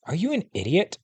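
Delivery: mildly exasperated, pitched high; a touch of disbelief, high-pitched; incredulous scolding. A narrator's reading. Sister thinks at her reckless brother, mildly incredulous.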